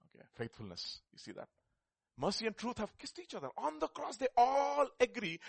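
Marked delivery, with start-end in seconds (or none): 2.2-5.4 rising high and loud into the climax; building preacher's proclamation. Real, not acted. Preacher declaring the verse again to the church, building loud. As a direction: lift it up and declare it.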